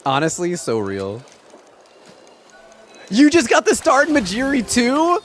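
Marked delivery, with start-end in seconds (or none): 3.1-5.2 excited surprise. Real, not acted. Friend also got the fish.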